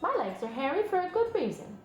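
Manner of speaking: patient spider explaining herself; Charlotte the spider. Acted. Charlotte answers Wilbur in a light spider voice.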